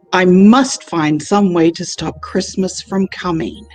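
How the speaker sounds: usual speaking voice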